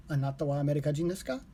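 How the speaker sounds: brisk, fluent repeat; quick run-through. Shown as a demonstration. Learner on stream repeating the question quickly. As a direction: rattle the question off at speed.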